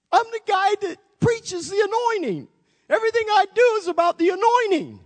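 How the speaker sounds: high-pitched flustered protest; high panicked protest. Retold or imitated. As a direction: squeal out the protest like a flustered man. Pastor protesting to God, high voice.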